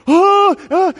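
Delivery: high, loud amazed "oh, oh"; overwhelmed with disbelief; stunned gasping. Retold or imitated. Clutching phone in shock.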